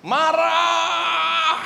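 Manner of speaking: a sudden loud shout of the one word. Acted. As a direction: bark the sin out like a warning. Khotbah menyerukan kata dosa. (teriakan keras satu kata)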